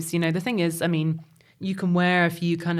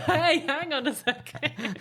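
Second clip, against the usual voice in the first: laughing protest; 7 semitones higher, slower than usual. Real, not acted. Laughing protest at British joke.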